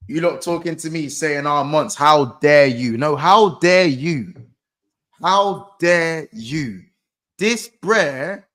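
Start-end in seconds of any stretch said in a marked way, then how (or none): 1.4-6.8 slow, drawn-out "how dare you", hit hard; indignant, stretching each word out with force; mock indignation. Host throwing critics' scolding back at them, mock indignant.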